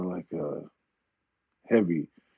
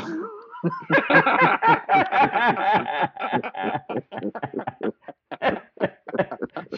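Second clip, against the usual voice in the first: high, helpless run of laughter; long laughing fit; 14 semitones higher than usual. Real, not acted. Guest tickled by his own Avengers answer, long high laugh. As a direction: crack up and keep on laughing.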